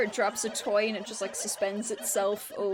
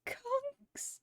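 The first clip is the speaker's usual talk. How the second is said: whispered question; 13 semitones higher, 9 dB quieter than usual. Pretend.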